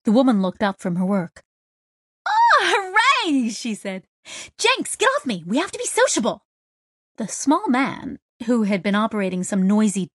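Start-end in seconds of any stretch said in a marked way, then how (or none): 2.3-3.5 high, long drawn-out hooray; excited cheer. A narrator's reading. Mech tech cheers a newcomer's arrival, excited and high. 4.6-6.4 high-pitched eager call; excited urging. A narrator's reading. Woman tells workmate to get down, high and excited. Kizzy calls Jenks off the job.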